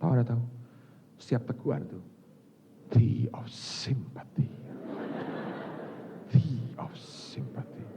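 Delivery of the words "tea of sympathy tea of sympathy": slow, soft, breathy relish; savoring whisper. Acted. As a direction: savor each word like sweet tea. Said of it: "Sampai ke pori-pori saya itu sampai berkata". (bisikan pelan penuh rasa)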